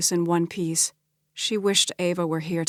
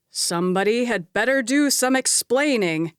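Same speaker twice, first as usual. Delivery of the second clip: loud, raised demand; roaring; 4 semitones higher, 4 dB louder than usual. Acted. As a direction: roar at the whole room. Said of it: "Alistair roared".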